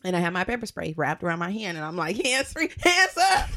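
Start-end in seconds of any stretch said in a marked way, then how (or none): 2.2-3.5 high, drawn-out hands-up command; high, slow, giggly command; mock standoff order. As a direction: laugh through a hammed-up police order. Acts out threatening with pepper spray.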